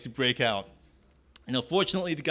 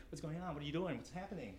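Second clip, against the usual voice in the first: nosy prying; 15 dB quieter, faster than usual. Retold or imitated. Nosy guy leans in.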